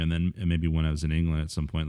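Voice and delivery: ordinary delivery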